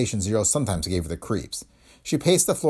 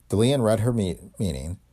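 ordinary delivery, nothing marked

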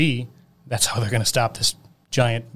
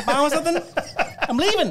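high mock character voice; faster than usual. Retelling a movie character's line.

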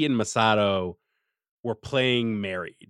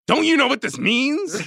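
furious; 13 semitones higher, 4 dB louder than usual. Retold or imitated. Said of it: "Asuka is just furious".

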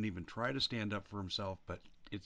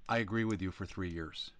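normal talking voice

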